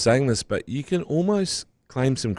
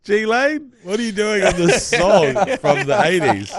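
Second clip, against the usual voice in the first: high, drawn-out incredulous outburst; mock disbelief; 6 semitones higher, slower than usual. Fan reacting to an absurd eighties lyric, mock disbelief. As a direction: exaggerate the baffled protest, pitched way up. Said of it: "And I was like".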